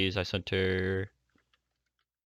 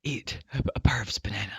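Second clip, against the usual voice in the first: whisper; 8 dB quieter than usual. Real, not acted. Whispered repeat of the line.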